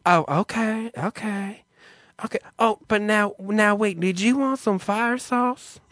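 feminine male clerk. Retold or imitated. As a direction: imitate a confused drive-thru guy checking the order. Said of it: "He's very feminine sounding".

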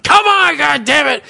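high, loud mock-furious holler; pissed-off dad yelling. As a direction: yell it like an impatient dad behind a closed window. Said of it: "He is fucking pissed".

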